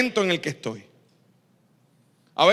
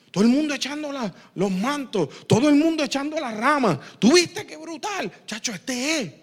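excited gossip; 6 semitones higher than usual. Retold or imitated. Excited bystander gossiping about Jesus.

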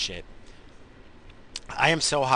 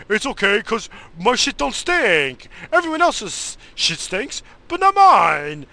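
high, slow, loud smug mock voice of a coworker; mocking Bobo; 10 semitones higher, 8 dB louder, slower than usual. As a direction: ham up a smug coworker bragging. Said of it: "that's exactly what he would say".

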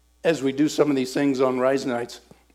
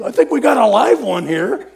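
higher, louder delighted call; excited leader; 9 semitones higher, 10 dB louder than usual. Retold or imitated. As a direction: announce it with gleeful excitement. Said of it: "Johnny goes".

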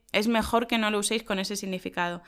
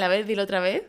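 ordinary talk

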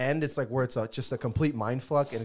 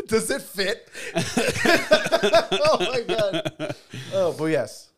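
laughing; 6 semitones higher, 7 dB louder, slower than usual. Real, not acted. Laughing at a word slip.

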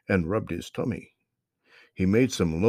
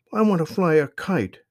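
put-on high, bright-eyed kid voice, a bit louder; little girl voice; 9 semitones higher, 6 dB louder than usual. A narrator's reading. Sister tells brother she wants a kite, high girl voice. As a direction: voice a young girl eager to fly a kite. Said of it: "she replied".

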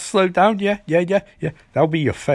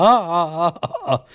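villain's laugh; 3 semitones higher than usual. Retold or imitated. Trickster's son joins the laughing, mock villain cackle. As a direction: imitate the son joining in with a put-on cackle. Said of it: "his son laughs".

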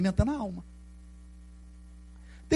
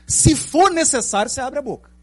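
emphatic preaching; 7 semitones higher, 6 dB louder than usual. Real, not acted. Preaching emphasis, high and loud.

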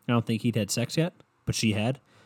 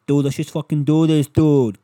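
loud, higher-pitched Boston caricature; mock Boston accent; 5 semitones higher, 10 dB louder than usual. Imitating the girl propositioning his buddy, loud Boston accent. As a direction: belt it out like a brash Bostonian. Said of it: "why she's from Boston now".

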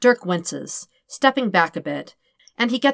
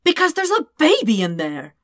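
exasperated; 7 semitones higher, 4 dB louder than usual. Acted. Dirk exasperated, obvious answer.

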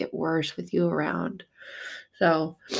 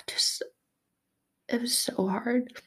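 tearful whisper; 7 semitones higher, 5 dB quieter than usual. Real, not acted. Grieving over her dead dog.

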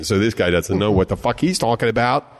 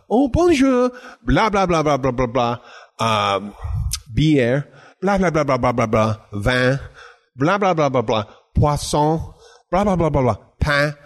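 imitating rapid French. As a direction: mimic a fast French speaker you can't follow. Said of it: "and then I heard".

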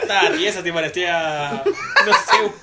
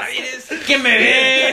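teacher shout; 7 semitones higher, 5 dB louder, much slower than usual. Retold or imitated. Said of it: "teacher shouting the name".

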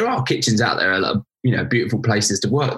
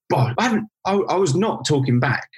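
high-pitched retold self-defence; mock-pleading objection, pitched up; acted protest; 7 semitones higher than usual. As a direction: protest your innocence in a raised pitch. Acted protest to angry chef.

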